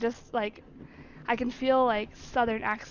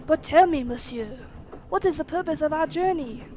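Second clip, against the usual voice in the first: put-on louder, higher French-accented question; 4 semitones higher, 5 dB louder than usual. Servant asking master about the journey's purpose, French accent. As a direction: ask it in an emphatic French accent. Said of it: "French accent is so much easier".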